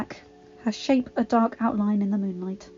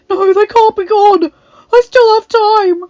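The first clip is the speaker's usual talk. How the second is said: panicked; 12 semitones higher, 14 dB louder than usual. A narrator's reading. Adam panicking over lost servants.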